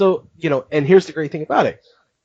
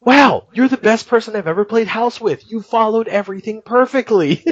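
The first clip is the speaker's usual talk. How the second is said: mock delighted praise; 8 semitones higher than usual. Retold girl thrilled with him.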